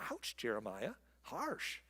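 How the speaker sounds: ordinary talk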